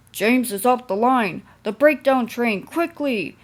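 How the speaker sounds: loud urgent call; shouted alarm. A narrator's reading. Signalman shouts for the breakdown train, loud. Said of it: "he shouted".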